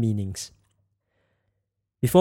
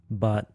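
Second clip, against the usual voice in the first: BUT said low; 5 semitones lower, 5 dB quieter than usual. Shown as a demonstration. Teacher demonstrates tones with English sounds, low tone. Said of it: "So, the English sounds".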